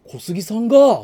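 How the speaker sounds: 小杉さんが said high and loud, rising; uptalk. Shown as a demonstration. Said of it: "って言って、 あ、上げたら".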